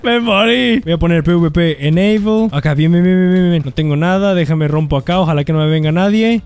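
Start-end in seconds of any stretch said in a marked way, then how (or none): 0.0-0.8 high-pitched cry of defeat; dismayed exclamation. Real, not acted. Player announcing his death in game, dismayed. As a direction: exclaim it high, just beaten.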